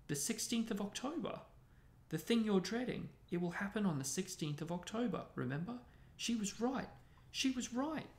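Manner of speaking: high, distressed wail in words; tragic. A narrator's reading. Lavender recalls the prediction about her rabbit, tragically. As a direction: lament in a high, upset voice. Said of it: "said Lavender tragically".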